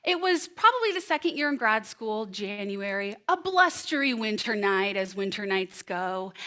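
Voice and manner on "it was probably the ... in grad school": ordinary talk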